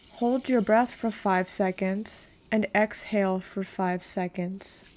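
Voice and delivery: usual speaking voice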